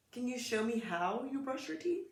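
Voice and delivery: ordinary delivery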